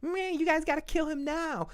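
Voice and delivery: very high, whiny falsetto; mock tantrum. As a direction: throw a hammed-up fit in a squeaky voice. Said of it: "she didn't pull a fit".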